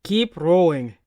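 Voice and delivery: sharp loud barked order; barking. A narrator's reading. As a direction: bark the command. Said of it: "Arnie barks".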